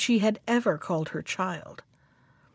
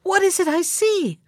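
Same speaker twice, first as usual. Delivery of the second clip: higher, alarmed question; horrified cry; 8 semitones higher than usual. A narrator's reading. Mother seeing vipers fall from her daughter's mouth, asking in high alarm. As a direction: recoil from what she sees. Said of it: "cried the mother".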